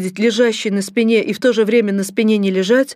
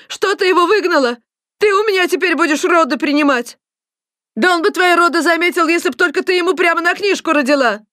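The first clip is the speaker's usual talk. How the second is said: high-pitched indignant squabbling; angry quarrel; 9 semitones higher, 3 dB louder than usual. A narrator's reading. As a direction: fire back at the other woman. Women quarrel in the ward. (крикливая перебранка на высоких тонах)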